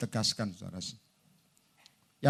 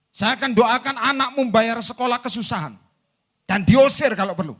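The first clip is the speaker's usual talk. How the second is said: raised, forceful pronouncement; mock threatening prayer; 6 semitones higher, 8 dB louder than usual. Preacher threatens a member with hardship, loud mock threat. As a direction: proclaim the mock curse loudly.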